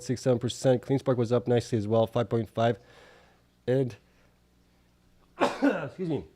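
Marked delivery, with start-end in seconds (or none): 5.4-6.2 coughing from allergies; cough, then a quick excuse me; allergy cough. Real, not acted. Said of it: "the allergies are kicking my butt".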